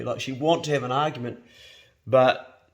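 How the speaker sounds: normal talking voice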